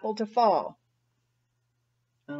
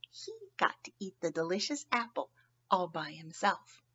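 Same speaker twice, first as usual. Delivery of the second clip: secretive whisper; 4 semitones lower, 11 dB quieter, faster than usual. Acted. Sharing the story's secret.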